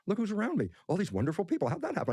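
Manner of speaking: amazed. Retold or imitated. Delighted surprise at wonderful people.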